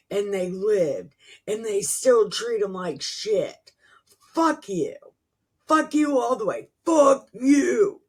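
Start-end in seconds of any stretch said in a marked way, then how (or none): 4.3-8.0 high-pitched, exasperated swearing, building louder; angry cursing. Real, not acted. Reader cursing the people in the scripture, angry outburst. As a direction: curse them out, hardest on the last one.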